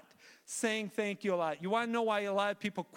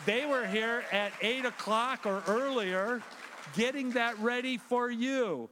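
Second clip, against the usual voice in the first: usual speaking voice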